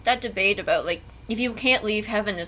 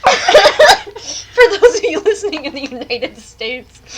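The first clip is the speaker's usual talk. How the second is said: mock announcer; 7 semitones higher, 10 dB louder, slower than usual. Mock-repeating friend's announcement.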